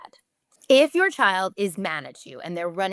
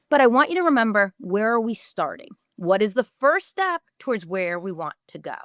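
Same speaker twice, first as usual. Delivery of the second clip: ordinary talk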